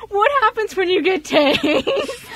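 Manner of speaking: high, slow question through laughter; laughing question. Retold or imitated. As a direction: ask it while cracking up. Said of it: "It's like".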